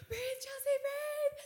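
high, loud, squeaky mock panic; panicked self-talk. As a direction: frantically coach yourself to calm down. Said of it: "I'm at work like this".

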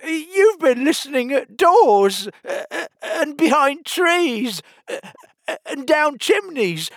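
very high, loud, spluttering outrage; passionate accusation. A narrator's reading. Humpty accuses Alice of eavesdropping, in a passion. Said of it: "breaking into a sudden passion".

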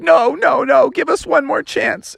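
put-on high childlike pleading voice; kids pleading. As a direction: beg like little kids. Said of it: "all the kids were like".

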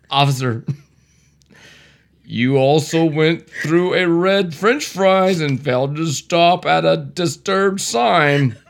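ordinary talk, nothing marked

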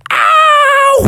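loud, high drawn-out ow; mock pained yell. Players stung by bees, yelling in pain. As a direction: yelp as if just stung. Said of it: "the bees stung all three".

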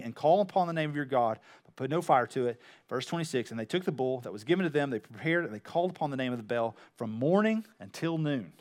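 low, soft reading voice; subdued Bible narration; scripture reading. A narrator's reading. Reads scripture aloud to congregation.

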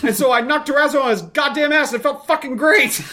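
put-on high, proud little boy voice; boy innocently repeating coach's curse to dad; innocent kid. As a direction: say it like a kid who knows no better.

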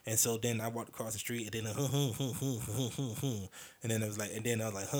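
ordinary talk

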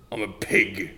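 ordinary talk, nothing marked

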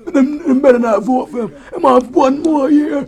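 put-on comic Jamaican accent of an aging ex-player; old Jamaican man voice. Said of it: "the old Jamaican man voice".

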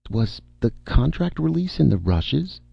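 high, dreamy question; vague. A narrator's reading. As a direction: ask as if barely following. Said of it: "D D asked vaguely".

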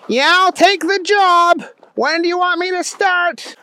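high, eager cartoon voice; Booger McFarland. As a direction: accept keenly in a high, goofy voice. Booger eagerly accepts the job.

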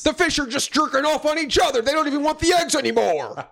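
squeaky high joke voice; silly high voice. Pretend.

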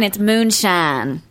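slow country drawl, MOONSHINE as "moon-shahn"; country accent. Shown as a demonstration. Co-host corrects James's word, country accent on "moonshine". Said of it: "it isn't moonshine".

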